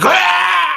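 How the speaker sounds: sudden high cry of pain; pained shout. Acted. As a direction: yelp as if struck. Victim cries out attacked.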